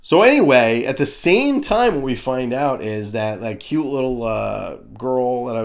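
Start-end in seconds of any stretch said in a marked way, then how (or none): none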